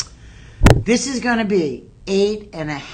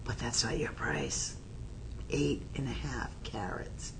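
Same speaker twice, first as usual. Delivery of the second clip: conspiratorial whisper, much softer; 5 semitones lower, 13 dB quieter than usual. Real, not acted. Host whispering to viewers before dropping the price. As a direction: lean in and let them in on a secret.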